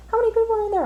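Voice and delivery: high coworker voice. Retold or imitated. Teller imitating her coworker's question, pitched high. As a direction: mimic the coworker asking in a high voice.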